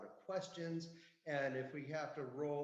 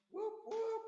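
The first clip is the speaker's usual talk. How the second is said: very high celebratory whoops; whooping. Retold or imitated. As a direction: imitate a partying crowd's high whoops. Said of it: "They are whooping it up down there".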